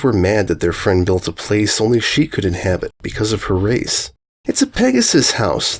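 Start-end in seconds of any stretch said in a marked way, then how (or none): 4.4-5.8 high protesting filly cry; indignant high cry; cried out. A narrator's reading. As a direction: fillies crying out in protest. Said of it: "they cried".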